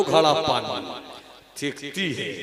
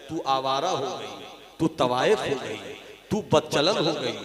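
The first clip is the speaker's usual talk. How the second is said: usual speaking voice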